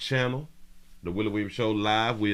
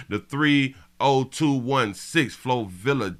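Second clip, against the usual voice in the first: ordinary talk, nothing marked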